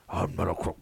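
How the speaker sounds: lightly put-on disgraced-president voice; Richard Nixon. Host quoting the disgraced president, low impression. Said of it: "Richard Nixon".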